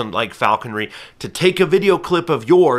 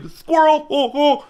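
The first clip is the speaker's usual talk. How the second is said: much higher, louder yelp of a distracted dog; excited dog voice; 14 semitones higher, 4 dB louder, slower than usual. Falconer voicing a dog that spots a squirrel, high and excited. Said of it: "he momentarily was like".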